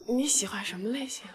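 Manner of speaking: usual speaking voice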